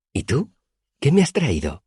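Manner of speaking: put-on high, bright teasing girl voice; playful Auri. A narrator's reading. Auri teasing her friend about gifts, high playful voice. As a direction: toss the question back playfully.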